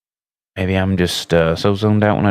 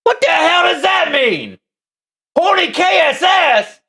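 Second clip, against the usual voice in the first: incredulous shout. Real, not acted. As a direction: yell it in total disbelief. Reads a crude username.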